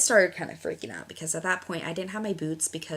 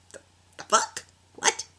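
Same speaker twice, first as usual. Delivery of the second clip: louder, breathy stunned "the fuck?"; shocked disbelief; 12 semitones higher, 5 dB louder than usual. Retold or imitated. Hearing her friend say they're pregnant, stunned and louder. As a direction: caught completely off guard.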